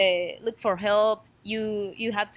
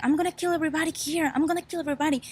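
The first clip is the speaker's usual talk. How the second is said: retold yelling; 7 semitones higher, much faster than usual. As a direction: imitate a man's yelled threat in raised pitch. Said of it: "Guy was yelling like".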